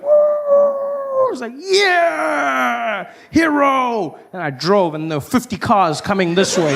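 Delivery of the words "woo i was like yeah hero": high, drawn-out victory cheer; triumphant whoop. Retold or imitated. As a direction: crow like a hero who just escaped. Triumphant after swerving.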